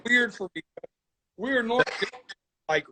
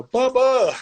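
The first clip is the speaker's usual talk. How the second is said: 1 playful Bubba greeting; 9 semitones higher, 5 dB louder than usual. Retold or imitated.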